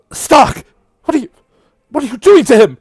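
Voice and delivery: high, loud frightened cry for Stark; alarmed shout. Acted. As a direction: scream at her to stop. Iris cries out in alarm.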